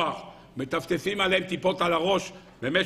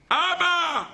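put-on high shouted child's cry; 10 semitones higher, much slower than usual. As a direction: cry "אבא" like a frightened grandchild. Grandchildren scream by the bed. (נכד זועק אבא)

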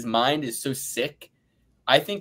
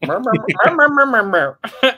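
loud, high squealing laugh; high burst of laughter; 10 semitones higher, 8 dB louder than usual. Real, not acted. Laughing at his own rant to the crew, high and loud. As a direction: crack up loudly.

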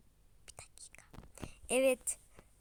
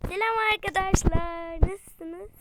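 cheerful greeting; 5 semitones higher, 7 dB louder than usual. Acted. Girl greeting friends at cafe.

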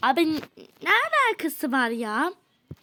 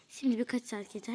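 unvoiced aside to the co-actor; whispering what happens next in the skit; whispered aside; 4 semitones lower, 8 dB quieter than usual. Real, not acted. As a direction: whisper the stage direction. Whispered stage direction aside.

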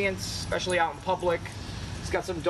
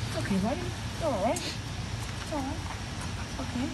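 high, soft, slow reassurance; gently calm the nervous pup; soothing a dog; 8 semitones higher, 4 dB quieter than usual. Real, not acted.

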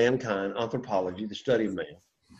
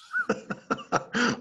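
high, light laughter; short run of high-pitched laughs; 9 semitones higher, 7 dB quieter than usual. Real, not acted. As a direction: break into a high chuckle. Teacher laughs during class drill.

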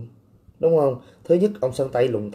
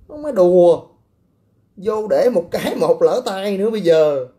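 louder and higher, swaggering warning; boastful threat; 7 semitones higher, 5 dB louder than usual. Real, not acted. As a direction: puff up and warn him off. (giọng to, cao, hăm dọa khoe mẽ)

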